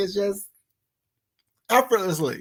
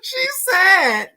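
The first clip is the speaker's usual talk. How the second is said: high, laughter-filled voice; laughing helplessly; much slower than usual. Real, not acted. Reactor explaining the cooking joke, laughing helplessly. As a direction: struggle to get it out through laughs. Retelling the joke while cracking up.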